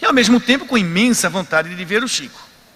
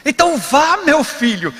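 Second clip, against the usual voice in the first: higher, louder fatherly urging; a hint of gentle Chico coaxing him on; kind old medium; 8 semitones higher, 4 dB louder than usual. Chico Xavier urging the weeping visitor in, higher fatherly voice.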